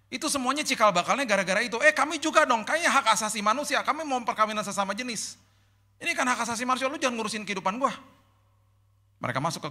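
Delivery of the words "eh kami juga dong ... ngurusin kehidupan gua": higher, pushy mock protester voice; mocking whiny demand. Preacher mimicking activists demanding rights, high and pushy. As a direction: mimic them insisting on their rights, higher.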